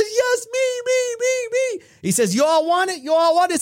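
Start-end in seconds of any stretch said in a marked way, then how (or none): 0.0-1.9 very high, eager pleading voice; eager attendee begging to be picked, squeaking high; eager squeal. Acted. Kim volunteering eagerly. 2.3-3.6 hype-man call. Acted. Jerome hyping the crowd.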